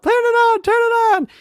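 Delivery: put-on high-pitched panicky kid squeal; mock freaking out. Frightened kids begging dad, mock high squeal. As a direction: plead in a very high imitation panic. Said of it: "we're freaking out".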